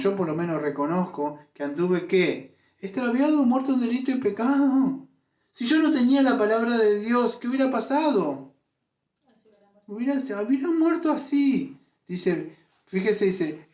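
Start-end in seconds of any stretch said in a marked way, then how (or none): 2.8-11.8 rising fervor. Real, not acted. Preacher builds emotional climax.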